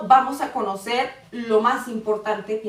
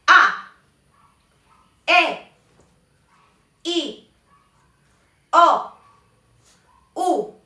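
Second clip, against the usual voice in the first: "A, E, I, O, U" high, short, falling sharply; angry fourth tone; 5 semitones higher, 6 dB louder, much slower than usual. Shown as a demonstration. Teacher demonstrating the fourth tone, angry falling vowels. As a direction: chop each vowel "A, E, I, O, U" downward as if angry. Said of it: "la expresión de enojado".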